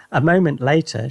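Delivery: ordinary delivery, nothing marked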